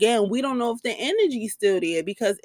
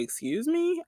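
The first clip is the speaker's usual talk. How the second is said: high, soft incredulous excuse me; 8 semitones higher, 8 dB quieter than usual. Retold or imitated. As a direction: sound stunned and offended. I made this whole face.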